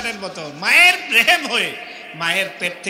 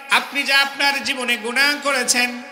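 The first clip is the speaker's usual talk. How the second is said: normal talking voice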